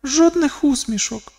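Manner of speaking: високий внутрішній голос; подумай це тонким, високим голосом; думка Каліші. A narrator's reading. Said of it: "подумала Каліша". (високий голос думки дівчини)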